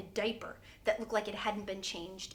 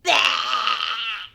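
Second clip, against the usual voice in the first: put-on loud shouted baby bah; spooky baby blurts it out; ghost baby bah; 12 dB louder than usual. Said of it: "she goes".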